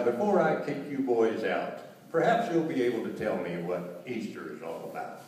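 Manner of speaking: normal talking voice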